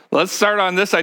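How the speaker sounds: normal talking voice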